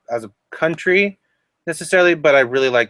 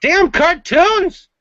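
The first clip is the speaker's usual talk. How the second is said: mock outrage; 14 semitones higher, 4 dB louder, much slower than usual.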